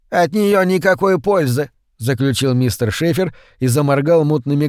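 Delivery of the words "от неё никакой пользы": put-on high, thin old man voice; a frail old man brushing it off. A narrator's reading.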